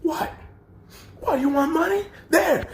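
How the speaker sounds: very high, near-crying mock fury; angry distorted rant. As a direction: hurl it at him, voice cracking. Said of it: "angrily distorted".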